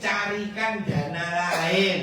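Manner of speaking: diulang lebih keras dan tegas; nasihat keras dan tegas. Real, not acted. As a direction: tekankan perintahnya dengan keras. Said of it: "nasihat tegas diulang". (ustaz mengulang nasihat, lebih keras dan tegas)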